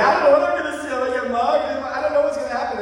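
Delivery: high, panicky mock fear; scared young self. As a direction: act out the scared kid's nerves. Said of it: "I was like".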